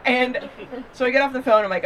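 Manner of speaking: ordinary talk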